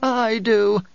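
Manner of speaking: high, pleading call; anguished cry. A narrator's reading. Bereaved woman to the oracle, crying out. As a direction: cry out from far down in the crowd. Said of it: "the woman cried".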